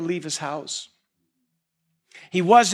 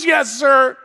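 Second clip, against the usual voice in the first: higher, loud yes sir; dutiful son; 7 semitones higher, 9 dB louder than usual. Retold or imitated. Perfect son answering his father, loud and higher. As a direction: snap to obedience. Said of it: "He always says".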